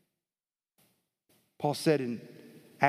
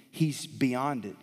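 ordinary talk, nothing marked

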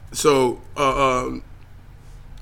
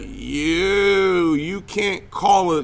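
mock disbelief; 3 semitones higher, much slower than usual. Mock response to offensive Nazi comparison.

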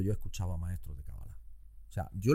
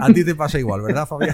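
louder, higher, laughing as you speak; amused, laughing; 5 semitones higher, 14 dB louder than usual. Real, not acted. Host to guest who chimed in, laughing. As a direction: tease warmly through a laugh.

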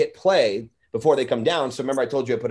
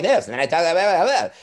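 high, quick sped-up chatter; 4 semitones higher, 4 dB louder than usual. Shown as a demonstration. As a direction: imitate a recording played fast. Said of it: "a voice that sounds like this".

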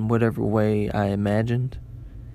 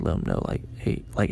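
whispered to himself; 5 semitones lower, 6 dB quieter than usual. Retold or imitated. Planning a nod to stranger.

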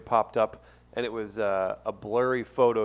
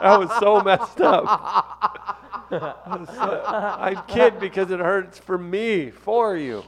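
higher voice, breaking up with laughter; laughing; 6 semitones higher, 4 dB louder, slower than usual. Real, not acted. Cohost admits the joke hurts, laughing helplessly. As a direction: try to talk through laughter you can't hold back. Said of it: "He wants to laugh so bad".

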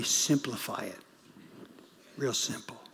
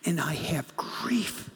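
hushed whisper, slowing at the end; slower than usual. Retold or imitated. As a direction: breathe it out like a confession. Father's testimony, continued.

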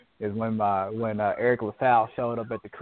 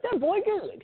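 mock audience member. Quoting audience member at the show.

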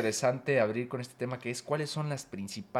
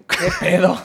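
loud, higher Chilango "qué pedo" at the locals; mock Chilango scolding; 6 semitones higher, 12 dB louder than usual. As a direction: jab at the Guadalajara folks in a Chilango accent. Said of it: "pinches tapatíos".

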